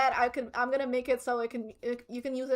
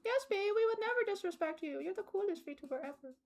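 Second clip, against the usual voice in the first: pleading hostage voice; 5 semitones higher, 7 dB quieter than usual. Pretend. Voicing hostage viewers.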